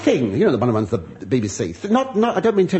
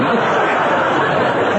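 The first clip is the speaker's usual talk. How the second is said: own giggle; 6 semitones higher, 4 dB louder than usual. Real, not acted. Laugh at own joke.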